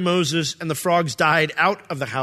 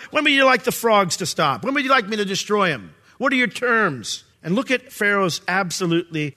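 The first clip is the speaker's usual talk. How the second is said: ordinary delivery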